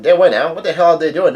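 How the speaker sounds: hammed-up cry of disbelief; mock yelling. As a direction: imitate friends yelling in dismay. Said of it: "yelling, crying out".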